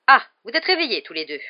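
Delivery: an abrupt, no-nonsense nurse; brusque. A narrator's reading. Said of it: "d'un ton brusque". (ton brusque)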